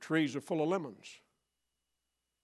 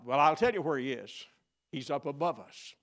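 ordinary delivery

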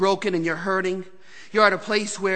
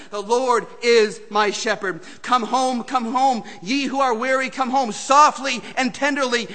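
louder, driving sermon cadence; preaching at full force; 4 semitones higher, 7 dB louder than usual. Acted. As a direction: press the point home. Preaching, quoting hymn.

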